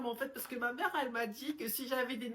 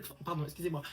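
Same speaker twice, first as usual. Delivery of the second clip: ordinary talk, nothing marked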